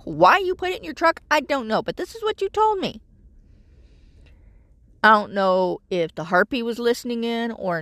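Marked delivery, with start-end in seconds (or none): 0.0-3.0 much higher, voice climbing to a squeak of disbelief; exasperated scolding. Retold or imitated. Venting at her son over the missing form, climbing higher. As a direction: pitch shoots up as patience runs out. Retelling a phone argument with son.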